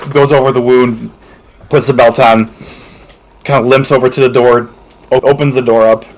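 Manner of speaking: ordinary talk, nothing marked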